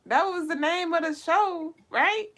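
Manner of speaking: high amused explanation; incredulous. Real, not acted. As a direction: explain it like it's obvious.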